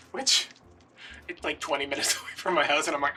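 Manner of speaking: ordinary delivery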